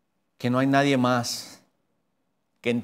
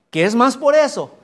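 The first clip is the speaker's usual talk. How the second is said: "Que es más por eso" called out much higher and louder; emphatic exclamation; 8 semitones higher, 7 dB louder than usual. Real, not acted. Preacher insisting to congregation, much higher and louder. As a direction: hit "por eso" hard as a cry.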